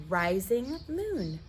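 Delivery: usual speaking voice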